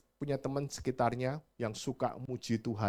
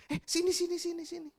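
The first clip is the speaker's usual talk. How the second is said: mock seller calling, pitched very high; calling back; 3 dB quieter than usual. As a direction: beckon the customer back eagerly. Seller calls him back.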